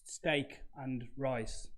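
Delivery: "steak and rice" drawn out word by word; slowly spelled out. Retold or imitated. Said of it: "now he said it like that".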